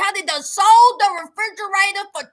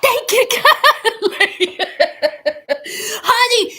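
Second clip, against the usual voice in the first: laughing; 5 semitones higher, 5 dB louder, slower than usual. Real, not acted. Laughing thanks to viewers.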